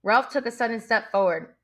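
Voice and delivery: ordinary delivery, nothing marked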